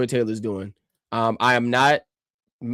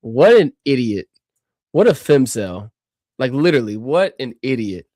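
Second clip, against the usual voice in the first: disgusted scorn; 5 dB louder than usual. Real, not acted. Reacting to pundit clip.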